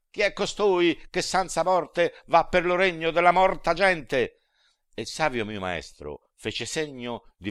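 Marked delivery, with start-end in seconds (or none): 0.1-4.4 high, loud, indignant challenge; angry demons. A narrator's reading. Fallen angers at the gates challenging the living man, high loud indignation. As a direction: spit the question at the intruder. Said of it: "che stizzosamente dicean".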